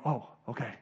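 very soft, low acceptance; subdued, low reply; chastened realisation. Acted. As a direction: murmur it as if brought to your senses. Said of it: "to go".

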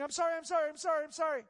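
mock pleading of a sinner; mock grovelling. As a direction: overdo the desperate apology. Mocking grovelling confession.

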